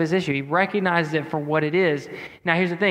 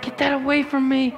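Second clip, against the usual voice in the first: high-pitched, comic protest; playfully overwrought, pitched way up; mock upset refusal; 10 semitones higher than usual. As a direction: push it away in a high, strained plea. Recoiling from picture of his dog.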